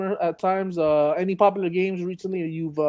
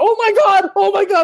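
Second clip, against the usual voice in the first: high, loud panicked cry; 14 semitones higher, 7 dB louder than usual. Retold or imitated. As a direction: panic as zombies rush you. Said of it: "You're like".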